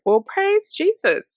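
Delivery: high, slow sarcastic praise; mock praise. Real, not acted. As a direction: stretch it out high like mock church joy. Sarcastic relief at good behaviour.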